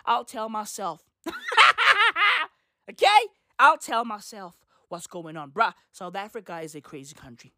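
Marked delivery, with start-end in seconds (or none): 1.2-2.5 real laugh at his own joke; high laughing shout. Real, not acted.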